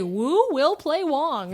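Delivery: Wu will play Wong said slowly, each word spaced out; carefully over-enunciate Wu will play Wong; slow careful tongue twister. Shown as a demonstration. Tripping over the tongue twister, slow and careful. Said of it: "that's actually hard to say".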